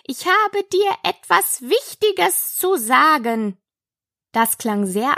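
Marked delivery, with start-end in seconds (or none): 0.0-3.6 high, deliberate caterpillar voice; inviting, high-pitched announcement; calling caterpillar. A narrator's reading. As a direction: lure her back, word by word. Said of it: "rief die Raupe ihr nach".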